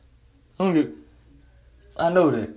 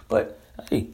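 whispered aside; 8 semitones lower, 8 dB quieter than usual. Real, not acted. Whispered aside mid-rant.

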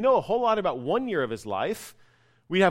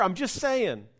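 ordinary delivery

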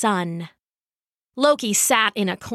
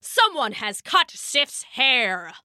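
outraged thunder god's roar; 5 semitones higher, slower than usual. A narrator's reading. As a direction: roar the terrible news to your father. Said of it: "roared Thor".

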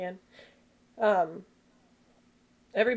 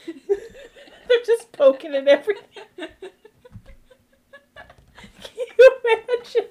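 laughing; 13 semitones higher, 8 dB louder than usual. Real, not acted. Laughing through the joke.

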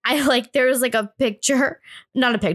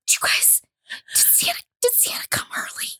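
imitated child's awestruck whisper; freaked-out whisper; 5 dB quieter than usual. Little girl asking family about Santa, freaked-out whisper. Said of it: "I was, like, freaking out".

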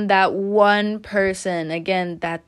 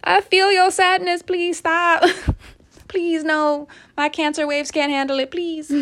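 mock pleading; 8 semitones higher than usual. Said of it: "I'm just like".

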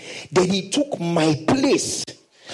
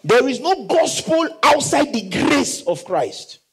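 fervent preaching; 7 semitones higher, slower than usual. Real, not acted. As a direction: drive it home from the pulpit. Preaching at full force.

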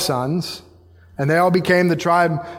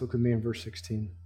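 normal talking voice

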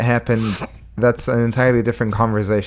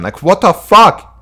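exasperated outburst; 9 semitones higher, 5 dB louder than usual. Real, not acted. Outraged at critics.

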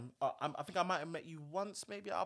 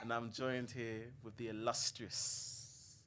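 slow, grand show-host build-up; host intro; 7 dB quieter, slower than usual. Acted. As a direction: announce the guest grandly. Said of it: "hyped host introduction".

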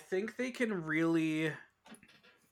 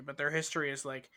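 ordinary talk

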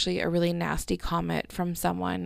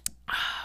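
soft breathy sigh after a drink; relieved sigh; 6 dB quieter than usual. Real, not acted. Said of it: "that felt so good".

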